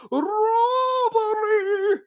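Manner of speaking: very high, loud and stretched out; tangled official wailing drawn-out cries; drawn-out shriek. Acted. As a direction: wail each word long.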